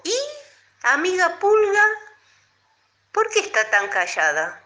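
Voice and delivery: hint of a toad, high and loud; lightly voiced toad. A narrator's reading. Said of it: "Entonces el sapo le dijo".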